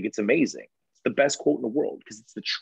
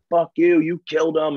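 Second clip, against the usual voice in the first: loud mock yell of grieving family; shouted accusation, retold; 4 semitones higher, 6 dB louder than usual. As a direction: throw the curse back hard, imitating them. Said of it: "They were like".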